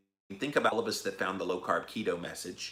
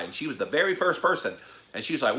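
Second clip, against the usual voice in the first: ordinary talk, nothing marked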